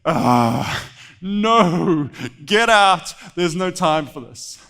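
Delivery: deflated groan into loud brush-off; loud dismissal. Acted. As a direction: send the kid away, exasperated and loud. Saul rejects the young boy.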